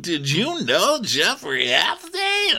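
high, emphatic interviewer voice; exaggerated talk-show host grilling; Andy. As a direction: put the question with mock gravity, pitched high. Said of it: "Andy said".

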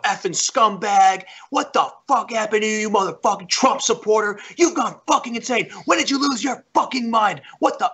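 high, strained mock tirade; snapped, retold rant. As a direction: imitate a man losing it, pitch jumping up. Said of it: "And then he snapped".

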